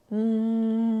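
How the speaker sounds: steady bee-like hum; humming. Shown as a demonstration. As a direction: hum with lips closed. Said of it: "let's practice".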